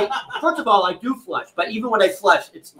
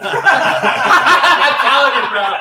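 laughing; 5 semitones higher, 5 dB louder, much slower than usual. Real, not acted. Laughing insistence.

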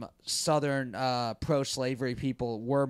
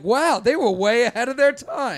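impressed; 8 semitones higher, 5 dB louder than usual. Retold or imitated. Mock impressed reader quote.

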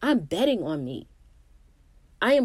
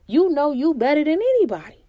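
loud, high affirmation; loud high rallying cry; 8 semitones higher, 8 dB louder than usual. Real, not acted. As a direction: belt it out with conviction. Emphatic motivational climax.

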